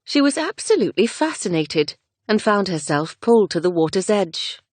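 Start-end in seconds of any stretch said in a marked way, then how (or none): none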